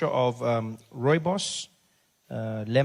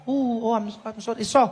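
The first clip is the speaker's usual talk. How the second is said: high, syrupy fake pity; mock sympathy; 8 semitones higher, 3 dB louder than usual. Clerk faking sympathy for the patient's sore throat, high mock pity. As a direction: pretend to feel sorry.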